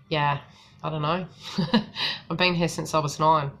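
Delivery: usual speaking voice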